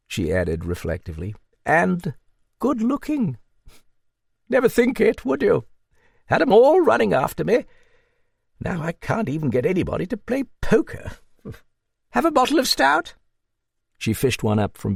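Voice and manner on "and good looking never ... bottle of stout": put-on high-pitched hearty old dame; a raised, hearty voice for the old lady; old Duchess.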